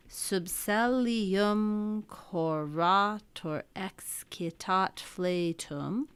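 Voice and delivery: very slow, syllable by syllable, marking the beats; very slow scanned reading of Latin verse; metrical reading. Shown as a demonstration. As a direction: draw the line out in its meter. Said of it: "let's see what we got here".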